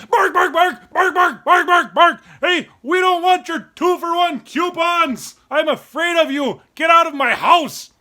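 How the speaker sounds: high, loud barking dog voice. Retold or imitated. Dog barking at the mailman, loud and high. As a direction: bark the mailman away. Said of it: "and I'm like".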